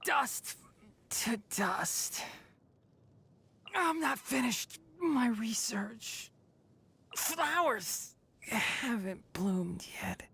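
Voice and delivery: slow, breathless whisper of a fallen fighter; fading away in a whisper; faint whisper. Acted. Defeated forest watcher fading out, whispering.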